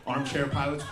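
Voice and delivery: usual speaking voice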